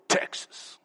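"Texas" breathed out soft and unvoiced, full of pride; proud breathy Texas. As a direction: savor "Texas" like a secret treasure. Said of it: "And I'd go".

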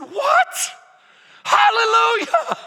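What? Hallelujah, shouted very high; overjoyed shout. Retold or imitated. Student hearing the professor's grace, high joyful shout. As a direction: burst out with disbelieving joy. Students told they all get an A.

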